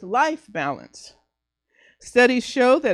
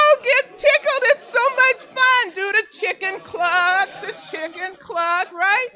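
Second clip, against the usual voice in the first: very high, giddy squeal; tickled silliness; 7 semitones higher than usual. Shown as a demonstration. Speaker coaxing the room into laughing yoga, silly high-pitched glee. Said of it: "just start laughing with me".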